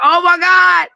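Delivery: dreading what comes next; alarmed. Retold or imitated. Babysitter hearing her sister curse at dad.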